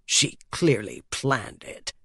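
understated sullen complaint, quietly delivered; grumbling. A narrator's reading. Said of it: "grumbled Stross".

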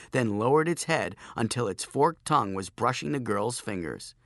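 ordinary delivery, nothing marked

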